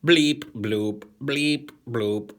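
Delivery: loud, high bleep bloop robot. Pretend. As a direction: belt the robot noises out loud. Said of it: "I am a robot".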